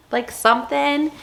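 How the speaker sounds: normal talking voice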